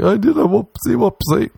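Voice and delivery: put-on high babyish confession; sheepish baby talk. Answering the girl, high sheepish baby talk. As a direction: own up like a small child.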